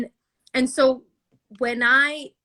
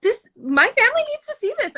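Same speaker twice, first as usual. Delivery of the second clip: excited; 12 semitones higher, 5 dB louder, faster than usual. Retold or imitated. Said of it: "I was like".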